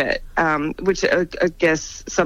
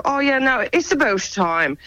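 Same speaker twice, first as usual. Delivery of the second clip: thick Atlantic Canada lilt, starting higher; Atlantic Canadian accent; 7 semitones higher than usual. Shown as a demonstration. Actor showing how to spot Atlantic Canadians, thick Atlantic Canadian accent. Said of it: "That person's from Atlantic Canada".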